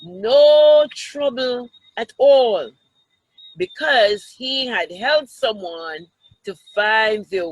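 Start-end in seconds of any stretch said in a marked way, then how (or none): none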